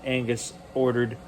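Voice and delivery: ordinary delivery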